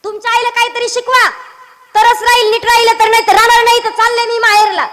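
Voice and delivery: high, loud wifely tirade; loud complaint. Acted. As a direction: yell the ultimatum so everyone hears. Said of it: "हळू नाही बोलत".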